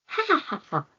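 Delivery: high cackling hyena laugh. Acted. Hyena laughs at Floki, high cackle. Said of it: "he said between laughs".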